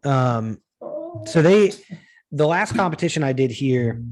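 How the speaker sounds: ordinary talk, nothing marked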